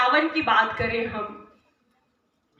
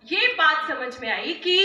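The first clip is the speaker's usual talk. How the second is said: ordinary talk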